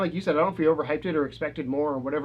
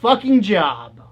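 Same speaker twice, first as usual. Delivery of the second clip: loud, furious rant at a blunder; mock angry yell; 4 semitones higher, 8 dB louder, much slower than usual. Host yelling at Sony for botching the job, mock furious. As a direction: yell it like the company wrecked everything.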